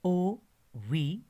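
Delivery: letters O V spelled out, drawn out and slow; spelled out slowly. Shown as a demonstration. Teacher spelling letters slowly for students. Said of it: "और ये word है".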